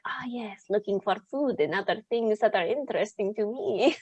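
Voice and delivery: soft whispered vampire musing. Acted. Player voices his sneaking vampire in a whisper. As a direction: breathe it out under your breath. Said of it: "He's just like".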